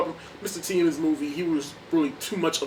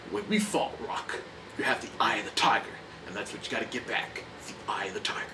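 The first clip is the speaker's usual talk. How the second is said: low, softer, fading to a hush; Apollo's quiet advice; 5 semitones lower, 6 dB quieter than usual. Retold or imitated. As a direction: lean in and press it home. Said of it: "he even mentions it".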